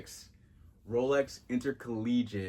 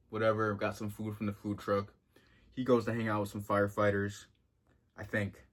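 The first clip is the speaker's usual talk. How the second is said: ordinary delivery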